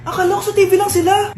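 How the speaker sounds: normal talking voice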